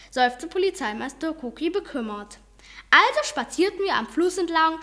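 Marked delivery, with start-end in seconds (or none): none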